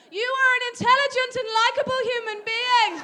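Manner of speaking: loud, high, like a phone speaker at full volume; blaring affirmation. Retold or imitated. Phone blaring affirmations to the whole carriage, loud and high. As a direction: blast it out like a recorded affirmation. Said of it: "phone had just been blaring".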